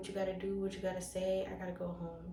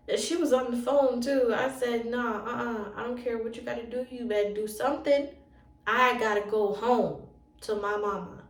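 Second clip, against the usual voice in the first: loud, desperate demand to go home; tearful loud plea to the social worker; loud pleading; 2 semitones higher, 10 dB louder than usual. Retold or imitated. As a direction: push it out loud and upset, near tears.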